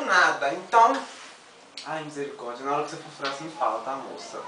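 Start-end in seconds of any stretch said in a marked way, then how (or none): 1.9-4.4 baixinho e com medo. Real, not acted. Pede baixinho antes do furo.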